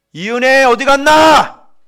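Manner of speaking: long loud call across the room. Real, not acted. Preacher calls out for a missing church member, loud and drawn out. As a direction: call out a name to the back.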